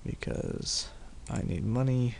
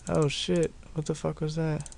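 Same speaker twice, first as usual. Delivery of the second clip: startled; 7 semitones higher than usual. Real, not acted. Sudden surprise mid-fight.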